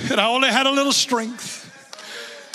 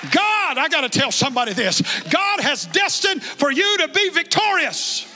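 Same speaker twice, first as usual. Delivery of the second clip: high, driving declarations ending in a long shout; full-force preaching; 9 semitones higher than usual. Real, not acted. Preacher tells congregation what God has called them to, full-force preaching. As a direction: proclaim each line, peak on the last words.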